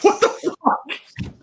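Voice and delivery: high, slow laughing what the fuck; laughing disbelief. Real, not acted. Guest cracking up at the subtitle, laughing disbelief. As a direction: squeak it out through laughter.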